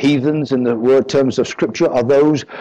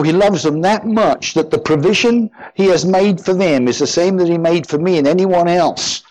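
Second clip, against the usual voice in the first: higher, rising preacher's fervor; fervent preaching; 5 semitones higher than usual. Acted. Preacher pleading that God loves the unbeliever, fervent. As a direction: build the appeal with rising passion.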